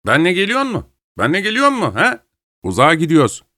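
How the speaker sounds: high, put-on voice for the dog; affected high-pitched voice. A narrator's reading. Man talking to his dog by the fireplace, affected high pitch. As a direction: pitch it way up, falsely playful. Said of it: "yüksek perdeden yapmacık bir sesle".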